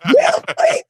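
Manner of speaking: high, surprised question; mock disbelief. Host hearing of thousands of advice requests, stunned. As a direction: act stunned. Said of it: "I'm like".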